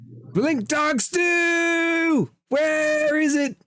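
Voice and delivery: high, drawn-out holler from a clownish cook. Acted. Cook bursts out of the kitchen hunting his ingredient, high clownish holler. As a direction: shout it high, stretching the words. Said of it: "And he goes".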